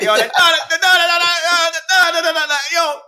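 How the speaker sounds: high, loud hyped-up MC chant; sound clash MC. Retold or imitated. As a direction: hype the crowd like a dancehall selector. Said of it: "you remember he'd be like".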